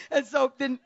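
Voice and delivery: normal talking voice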